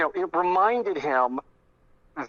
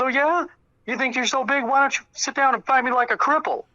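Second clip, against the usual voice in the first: much higher mimicked taunt, throwing it straight back; retold instant comeback; 10 semitones higher than usual. Mark turns on Tony without hesitation, retold mocking comeback. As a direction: fire back the challenge in a high mock voice. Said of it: "without hesitation, Mark just turns around".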